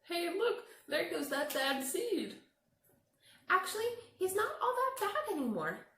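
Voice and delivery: high, loud chattering voices; high excited gossiping voices; gossiping bystanders. Acted. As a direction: point and whisper-gossip like nosy kids. Other seeds gossip about him.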